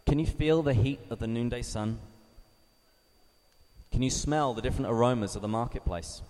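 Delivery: soft questions inviting them into the scene; guided meditation. Acted. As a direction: gently lead the listeners into the picture. Guided imagination.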